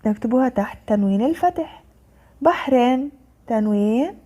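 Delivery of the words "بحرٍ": بحر read with an added -in ending; tanween kasr. Shown as a demonstration. Teacher reading out the word list, tanween kasr on بحر. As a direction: sound out بحر as "bahrin". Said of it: "كسر".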